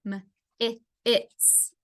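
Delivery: mitts split into m, i, its; segmented phonics demo of m, i, its; sounded out. Shown as a demonstration. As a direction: isolate m, then short i, then its. Teacher sounds out rhyme word.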